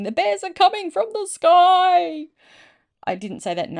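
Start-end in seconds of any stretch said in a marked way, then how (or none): 0.0-2.3 high, loud, drawn-out warning cry; hammed-up sailor's yell; sailor's panicked shout. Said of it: "One American sailor dove into the harbour shouting".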